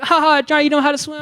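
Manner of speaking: high, mocking sing-song taunt; mocking classmates. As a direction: tease him high and nasty like schoolkids. Classmates mocking him at party.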